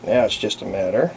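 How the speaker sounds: usual speaking voice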